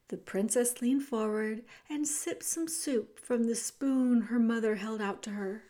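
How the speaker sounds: ordinary delivery, nothing marked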